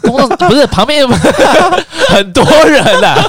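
high, loud words broken by laughter; laughing protest. Real, not acted. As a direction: laugh through the objection.

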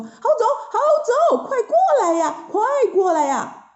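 high, long-drawn hollered call; calling across river. A narrator's reading. Monkey calls to companions on the other bank, hollering. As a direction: holler it across, stretching the key syllables. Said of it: "就朝着对岸的唐僧他们喊说".

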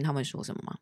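ordinary delivery

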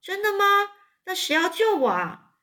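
high, astonished yelps; surprised cry. A narrator's reading. Wilbur cries out to Charlotte, high and astonished. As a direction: jump at sudden hope. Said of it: "韦伯就大叫说".

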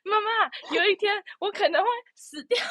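high, playful daughter telling mom; teasing singsong to mom; mock daughter voice. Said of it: "就是".

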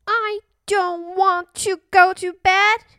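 put-on high, loud little-girl protest; defiant little princess. Little princess refusing to go to bed, defiant.

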